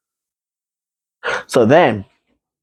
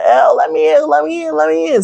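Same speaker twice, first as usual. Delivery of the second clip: rising into a very high, insistent plea; high mock pleading; 14 semitones higher than usual. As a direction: imitate someone begging to be let in. Brother pleading at locked door.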